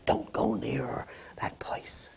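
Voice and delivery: slow whispered mother's warning. Retold or imitated. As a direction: breathe it like a worried mother. Kindly mother warning, voiced.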